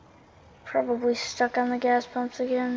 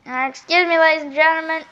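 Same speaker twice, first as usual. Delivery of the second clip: mock announcer; 5 semitones higher, 8 dB louder than usual.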